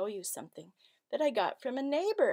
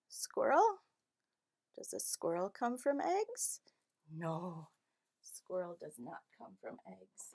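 usual speaking voice

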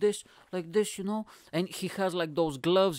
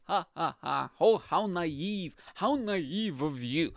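mock condescension; slower than usual. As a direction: fake laugh into slow, drawn-out scorn.

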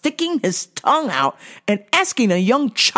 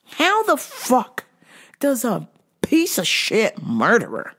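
ordinary talk, nothing marked

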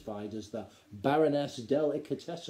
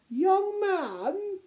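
very high-pitched grand lady; piping aristocratic address; imperious baroness; 4 dB louder than usual. A narrator's reading. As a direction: summon him haughtily. Baroness addresses the young gnome.

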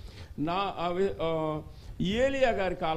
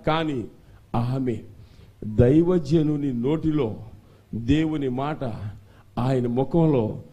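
slow, very loud preaching; full-force preaching; 5 semitones lower, 14 dB louder than usual. Real, not acted. Pastor exhorting church to clap, loud preaching. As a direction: thunder it to the congregation.

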